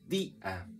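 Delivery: día in two separate beats, dí, then a; hiatus, stressed i. Shown as a demonstration. Teacher showing the written accent, día split as dí-a. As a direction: hold the í in día apart from the a. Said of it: "esta se tilda".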